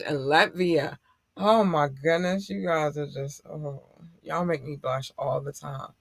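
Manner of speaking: ordinary talk, nothing marked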